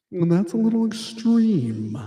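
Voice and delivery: ordinary talk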